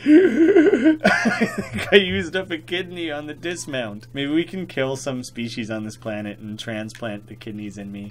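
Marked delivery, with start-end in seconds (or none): 0.0-4.1 laughing through the words; giggling as he speaks. Real, not acted.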